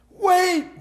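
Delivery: very high, loud bellow. A narrator's reading. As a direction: bellow across the street. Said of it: "bellowed Mister Brown".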